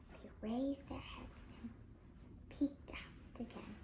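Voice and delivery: soft tense whisper. A narrator's reading. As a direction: whisper as if hiding. Cautious hiding narration.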